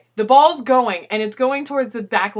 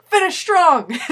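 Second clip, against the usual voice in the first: teammate's pep call; 7 semitones higher, 6 dB louder, slower than usual. Retold or imitated. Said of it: "Jason's like".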